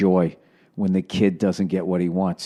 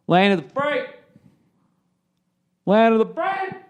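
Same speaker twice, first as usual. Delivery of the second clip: mock-anthem cry; 12 semitones higher than usual. Comic patriot hailing America, mock-anthem cry. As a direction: voice soaring high on "free".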